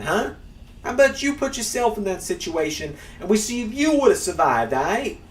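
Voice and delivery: a brash, bad New Yorker impression; New Yorker accent. Streamer mocking critics of a wrestler, in a put-on New Yorker accent. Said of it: "I tried to turn into a, to a New Yorker".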